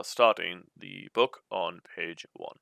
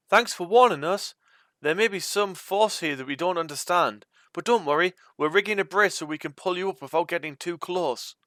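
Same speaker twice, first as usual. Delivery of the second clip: calling down; 10 semitones higher than usual. A narrator's reading. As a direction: shout down reassurance across the gap. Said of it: "Then Sneed yells".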